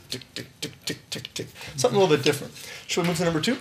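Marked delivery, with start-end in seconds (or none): 0.1-1.5 whispered tick tick tick imitating a hi-hat; soft hushed hi-hat imitation; mock hi-hat ticks. Shown as a demonstration. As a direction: tick it out under the breath like a hi-hat. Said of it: "oh, tick".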